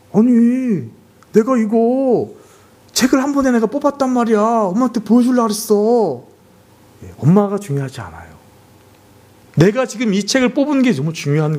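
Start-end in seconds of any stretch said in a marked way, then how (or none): 0.1-6.2 put-on eager child excuse. Child voiced defending himself.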